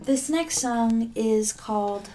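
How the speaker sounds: usual speaking voice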